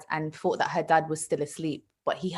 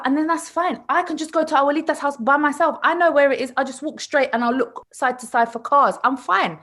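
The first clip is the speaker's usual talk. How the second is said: higher-pitched imitation of a self-assured little girl; know-it-all child; 5 semitones higher than usual. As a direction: reel off the plan like a five-year-old with every answer. Confident five-year-old answering back, voiced.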